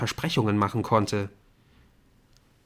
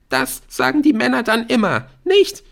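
high, loud, tearful accusation; upset girl's outburst; angry retort; 7 dB louder than usual. A narrator's reading. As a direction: throw it back at him shrilly. Aisha whirls round, lashing out.

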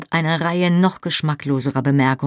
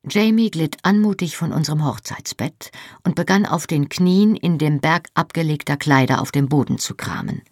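plain reading voice

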